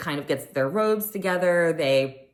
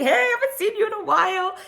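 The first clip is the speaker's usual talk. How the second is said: much higher, bubbly hello; mock gushing greeting; 3 dB louder than usual. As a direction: greet old friends with delight. Imitating Garcelle greeting masseuses.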